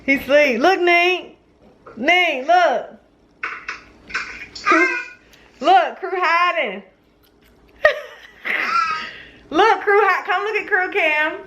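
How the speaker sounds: high excited coo. Real, not acted. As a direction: gush over the puppy. Cooing over the puppy.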